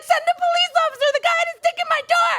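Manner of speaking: very high, fast, loud shouting; frantic demand. Retold or imitated. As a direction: yell it at the dispatcher. Panicked nine one one call.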